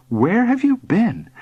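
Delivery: high, slow questioning; concerned mother. A narrator's reading. Mother to returning son, concerned. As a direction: ask with motherly worry.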